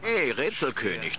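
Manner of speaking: ordinary talk, nothing marked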